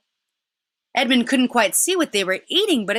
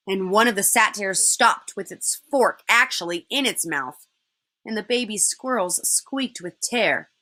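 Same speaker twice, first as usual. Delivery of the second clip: ordinary delivery, nothing marked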